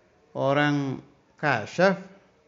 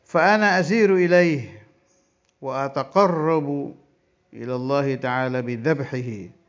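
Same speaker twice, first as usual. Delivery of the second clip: kitab recitation; 5 dB louder than usual. Acted. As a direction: intone the Arabic text like a pesantren teacher. Reads Arabic kitab text aloud.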